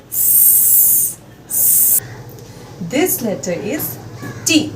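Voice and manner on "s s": long unvoiced "sss" like a hissing snake. Shown as a demonstration. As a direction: hiss S like a snake. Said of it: "like the sound of a snake hissing".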